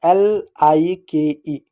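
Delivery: very slow letter-by-letter spelling; spelled out slowly. Shown as a demonstration. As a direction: draw out each letter name for pupils. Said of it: "इसको क्या पढ़ेंगे". (धीरे-धीरे, हर अक्षर अलग करके)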